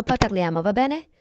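usual speaking voice

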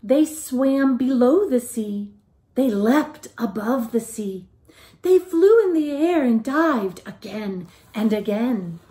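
lively, sing-song picture-book reading; reading to children. A narrator's reading. Teacher reading a picture book aloud to the class, lively and sing-song. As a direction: tell it like a bedtime story to little ones.

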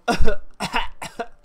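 loud hacking cough; a sudden loud coughing fit; harsh acted cough. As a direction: cough hard like a sick mother. Sick mother coughing.

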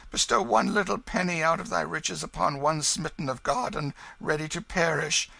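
high, wailing beggar's plea; miserable crying out. Acted. Hugo coaching the King to beg, mock wailing. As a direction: plead piteously in a put-on wail. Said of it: "cry out as all the devils of misery were in your belly".

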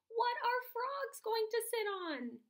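high, slow, drawn-out question; curious dog. Acted.

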